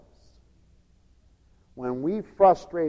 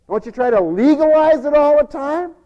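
normal talking voice

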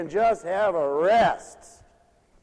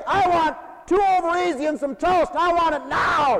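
loud, higher bossy breakfast order; demanding order; 9 semitones higher, 8 dB louder than usual. Retold or imitated. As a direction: bark the order at the waitress. Grumpy customer demanding breakfast.

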